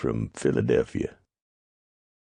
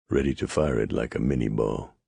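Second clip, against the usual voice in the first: plain reading voice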